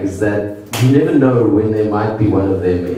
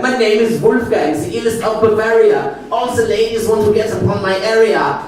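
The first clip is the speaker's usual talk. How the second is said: high, hammed-up rap boast in a German accent; mock German rapper; 14 semitones higher than usual. Imagined rapper Wolfgang introducing himself onstage, mock German rap boast. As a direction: swagger it like a cheesy Bavarian rapper. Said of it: "he's gonna be like".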